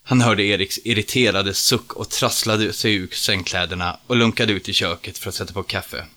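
plain reading voice

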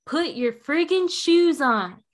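loud, slow, fed-up order; exasperated yell. Retold or imitated. Mom to daughter stalling on shoes, loud slow fed-up yell. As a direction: spell it out like the last warning.

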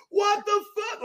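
very high cartoon yell of disbelief; Randy Marsh yell. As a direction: shriek it like a cartoon dad losing it at the TV. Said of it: "and yells".

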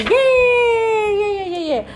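high squealing yeay; cheering. Acted. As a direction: cheer like happy pets. Animals cheering John leaving.

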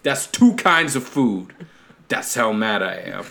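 hammed-up angry Italian family man; mock Italian accent. Host playing angry Italian family, exaggerated Italian accent. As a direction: threaten in a comic Italian accent. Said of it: "That's an Italian accent".

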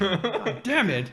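slow, high-pitched joking curse; mock frustration. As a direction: lament it playfully, drawn out through a laugh. Joking lament over rejection by McGill.

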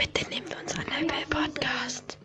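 pleading whisper to Apple; a begging whisper aside. Acted. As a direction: breathe the plea softly.

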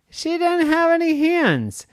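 hammed-up sorrow; mock lament. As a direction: wail it like a cartoon tragedy. Dramatic lament, high far voice.

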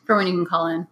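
ordinary talk, nothing marked

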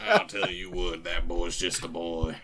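slow, gruff cowboy voice; gruff drawling voice. Retold or imitated. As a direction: puff up and drawl it out. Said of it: "talk like that".

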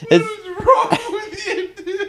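very high, squeezed through laughter; laughing disbelief. Real, not acted. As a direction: scold while cracking up. Laughing scolding over the lyrics.

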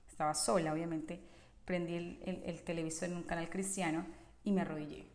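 ordinary talk, nothing marked

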